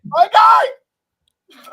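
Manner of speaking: loud, high cheer for a big hit; excited cheer. Real, not acted. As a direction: yell it out in pure joy. Celebrating a big home run.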